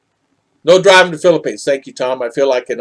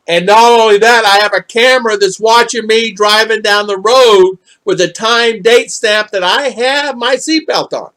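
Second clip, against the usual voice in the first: heated retort; 8 semitones higher, 6 dB louder than usual. Retold or imitated. Arguing back at the trooper.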